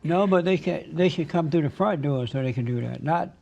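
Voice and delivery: ordinary delivery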